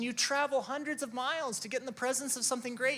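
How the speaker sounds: usual speaking voice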